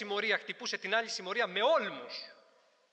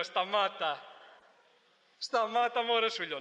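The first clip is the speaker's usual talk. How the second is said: insistent stop; 2 semitones higher, slower than usual. Retold or imitated. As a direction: tell him firmly to drop it. Urging him to stop.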